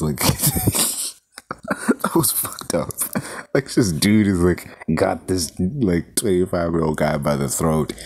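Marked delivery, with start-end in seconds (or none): 0.0-2.9 laughing. Real, not acted. Laughs at the scene.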